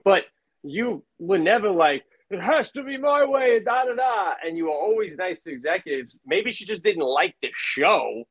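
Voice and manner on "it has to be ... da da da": higher-pitched mock diva; mock demanding creator. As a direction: lightly parody someone insisting on their way. Mimics a demanding show creator.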